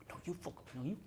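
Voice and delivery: furious-couple whisper, barely audible; hushed arguing. Acted. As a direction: act out a row in whispers. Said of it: "not shouting at one another. They're doing this sort of".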